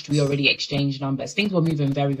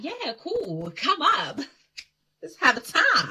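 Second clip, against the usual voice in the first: mock stoned agreement; 9 semitones higher, slower than usual. Retelling her stoned yes to him, high and dreamy. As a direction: float it out like you're high. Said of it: "And I was like".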